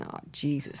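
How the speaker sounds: whispered, breathless panic; panicked whisper. Retold or imitated. Host whispering in panic after the bus mix-up. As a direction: mutter it under your breath in dread.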